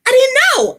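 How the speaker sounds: very high, loud disbelieving squeal; shocked squeal. Retold or imitated. Recalling discovering South American men, a high shocked squeal. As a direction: squeal it like the shock of your life. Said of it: "I was like".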